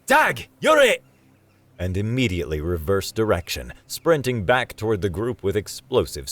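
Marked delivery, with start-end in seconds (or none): 0.0-1.0 high, loud playground taunt; full-voiced Aussie shout; gleeful yell. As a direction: yell it like a game of tag.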